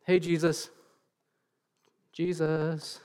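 soft, timid calling out; meek little attention-getting call; half-hearted call. Shown as a demonstration. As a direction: call politely, far too quietly to be heard. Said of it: "crying out is not".

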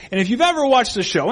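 ordinary talk

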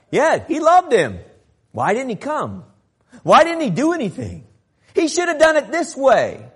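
high, loud, accusing complaint; indignant criticism. Acted. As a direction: demand to know why he failed them. Voices the doubting, critical onlookers.

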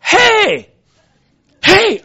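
loud, high "Hey!" called twice; retold shout. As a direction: yell to grab his attention. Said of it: "She leans over me and shouts".

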